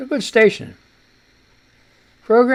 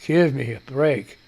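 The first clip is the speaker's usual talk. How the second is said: normal talking voice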